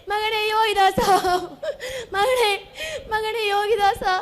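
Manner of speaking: keen over the dead son, voice breaking into sobs; wailing lament. Acted. Mother laments her dead son.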